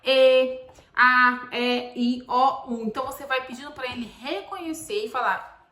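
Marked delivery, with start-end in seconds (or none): 0.0-2.8 loud, drawn-out vowel names A, E, I, O, U; vowel sounds. Shown as a demonstration. Mom teaching her son the vowels, loud and drawn out. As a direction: sing out each vowel clearly to a child. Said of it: "quais são as vogais no alfabeto".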